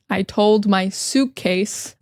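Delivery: drawn-out, careful repeat; slow it right down for learners; slow repetition. Shown as a demonstration. Teacher slowly repeating the key phrase. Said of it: "repeats slowly".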